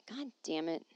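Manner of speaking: whispered curse under breath; frustrated whisper. Real, not acted. She can't find an email and whispers a curse. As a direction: hiss it in a whisper.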